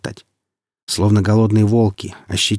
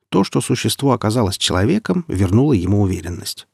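usual speaking voice

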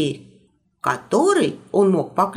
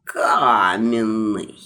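sarcastic, muffled; 9 semitones lower, much slower than usual. Acted. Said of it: "брызжущий благородным сарказмом, но слегка придушенный голос".

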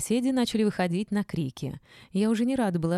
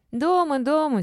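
put-on high, loud eager old women; eager confirmation; 10 semitones higher, 6 dB louder than usual. A narrator's reading. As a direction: chime in readily, pitched right up. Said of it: "синхронно закивали старушки".

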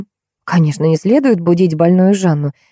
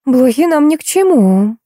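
musing, higher-pitched and lingering; thoughtful drawl; 7 semitones higher than usual. A narrator's reading. Wife considering the dog's fleas, thoughtful drawl. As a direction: draw it out as if pondering. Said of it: "задумчиво протянула Юля".

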